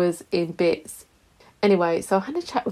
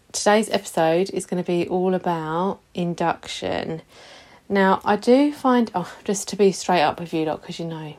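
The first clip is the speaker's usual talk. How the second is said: ordinary talk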